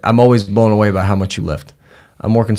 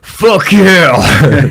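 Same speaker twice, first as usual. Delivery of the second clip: excited shout; 7 semitones higher, 6 dB louder than usual. Retold or imitated. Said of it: "you going".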